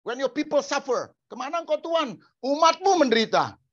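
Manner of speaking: high, loud, demanding prayer; preacher parody shouting at heaven, pitched high; mock pushy preacher. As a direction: parody a preacher ordering God down. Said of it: "kata-kata hamba Tuhan".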